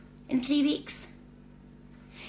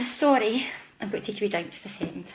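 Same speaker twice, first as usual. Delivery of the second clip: usual speaking voice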